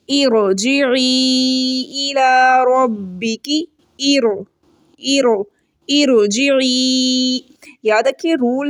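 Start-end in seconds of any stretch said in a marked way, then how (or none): 0.1-3.6 slow, drawn-out tajweed chant; Quranic recitation. Shown as a demonstration. Teacher recites the verse for her students, slow chant. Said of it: "रॉ को मोटा करके पढ़ा जाता है". 4.0-7.4 "इरो" with a full, thick rolled r; heavy r. Shown as a demonstration. Teacher shows students the heavy r in "इरजिई". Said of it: "रॉ को मोटा करके पढ़ा जाता है".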